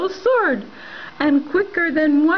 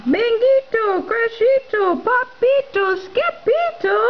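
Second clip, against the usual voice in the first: rising calls of names; calling out names; 8 semitones higher than usual. Acted. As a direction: call each name across the house. Mama calling kittens.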